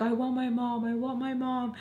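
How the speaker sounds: high, whimpering plea for mom; imitated crying. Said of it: "I start crying".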